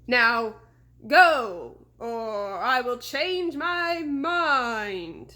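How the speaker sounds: slow, loud looming phantom voice; ghostly horseman. A narrator's reading. Fake horseman offering a bargain, slow and ghostly. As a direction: bargain like a patient spirit. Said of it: "Sabrina said".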